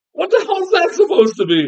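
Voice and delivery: high-pitched indignant bafflement; baffled. Retold or imitated. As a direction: demand an explanation in high disbelief. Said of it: "baffled by it".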